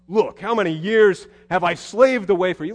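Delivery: elder son complaining to his father; bitter complaint. Acted. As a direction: vent years of grievance. Elder brother's bitter speech.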